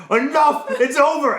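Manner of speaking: high, loud mock yell; imitated angry yell. Retelling his buddy yelling at a coworker. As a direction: shout it like the fed-up big guy. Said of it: "he's just like".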